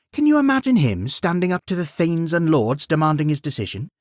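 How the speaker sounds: queen. Acted. Queen persuades Beowulf.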